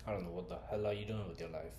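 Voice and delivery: ordinary talk, nothing marked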